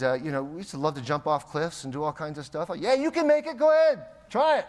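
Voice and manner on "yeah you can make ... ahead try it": high, loud mock cheer; shouted goading, pitched way up; daredevil egging on. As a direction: imitate a rowdy friend urging a dare. Voices daredevil friends egging on.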